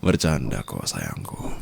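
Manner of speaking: soft, low, sugary; a lightly cooing endearment; mock-sweet tease. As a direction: pretend it was all a joke. Teasing endearment.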